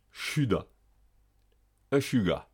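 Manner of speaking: "Chuda" and "Echuga" with a hushing sh; SH as French ch. Shown as a demonstration. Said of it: "S H se prononce comme le ch français". (« Chuda », « Echuga » avec ch français)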